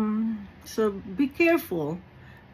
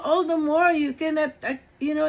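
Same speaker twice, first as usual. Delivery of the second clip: normal talking voice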